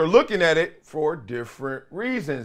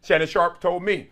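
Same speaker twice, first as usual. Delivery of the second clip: ordinary delivery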